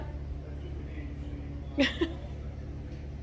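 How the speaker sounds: soft, breathy, tearful laugh; emotional laugh. Real, not acted. Overwhelmed by the new cabinets, soft emotional laugh. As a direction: let out a quiet, overwhelmed laugh. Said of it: "I'm getting really emotional".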